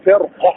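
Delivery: فرقة with a thick R before the qaf; heavy ra. Shown as a demonstration. Teacher shows practical application, heavy R in فرقة. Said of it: "التفخيم فخمناه".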